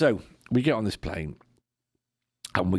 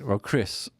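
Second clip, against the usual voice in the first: usual speaking voice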